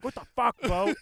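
loud, high mock outrage; outraged girlfriend. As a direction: yell it as the scandalized girlfriend. Imagined girlfriend reacting angrily.

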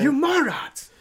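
high-pitched mock outrage; listener yelling insults. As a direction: berate the podcasters like an exasperated fan. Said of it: "someone's listening to this podcast, being like".

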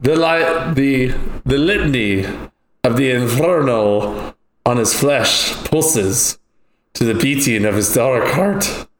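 slow, mysterious, with a hint of Irish. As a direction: draw it out like a dark prophecy. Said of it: "you talk mysterious".

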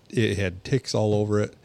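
normal talking voice

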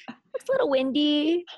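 high, hesitant little voice; hiding terror. Acted. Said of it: "'cause I'm terrified".

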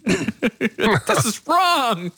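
high, very drawn-out protest; mock outrage. Friend joining the joke about the writer, mock outrage. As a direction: stretch it out in joking disbelief.

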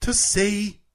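careful, lifted British "To see"; British accent. Shown as a demonstration. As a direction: echo the British announcer on "To see". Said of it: "I can't get the British act".

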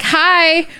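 put-on high, loud toddler call, drawn out; little kid greeting. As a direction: call out slowly to a stranger like a toddler. Toddler greeting a stranger by nickname.

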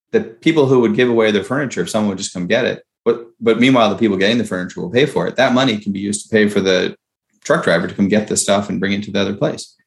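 ordinary talk, nothing marked

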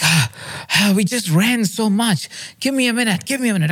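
high, strained plea between puffs; acted exasperated dad, pitched high; frustrated and winded. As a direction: beg for a minute, out of breath. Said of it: "I was getting a little frustrated".

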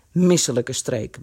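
low, slow grumble; grumbling. A narrator's reading. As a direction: grumble it low, drawing out the words. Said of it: "bromde Kees".

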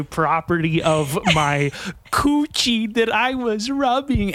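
slow, rising grand declaration; mock proclamation. Signing her name on the neighbor, slow and grandiose. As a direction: announce it like signing a decree, slowly. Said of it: "sign my name and say".

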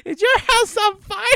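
put-on high, loud, squeaky old-lady question; senile old aunt. Imitating Aunt Bethany asking loudly about the house. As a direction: pipe it up like a confused granny.

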